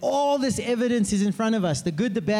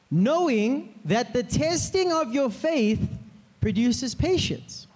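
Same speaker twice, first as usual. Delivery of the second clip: scripture reading; 4 semitones higher, slower than usual. Acted.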